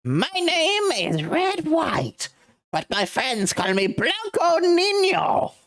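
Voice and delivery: high, slow, booming self-introduction; grand villain announcement. Acted. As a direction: proclaim your name like royalty.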